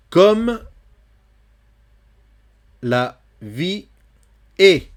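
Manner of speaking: vers lu haut et fort; poem reading. Shown as a demonstration. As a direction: lire le vers avec élan. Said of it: "lecture du poème". (lecture de poème sonore)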